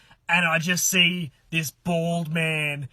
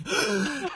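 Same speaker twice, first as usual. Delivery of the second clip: gasp; 5 semitones higher than usual. Retold or imitated. Reacting to being caught.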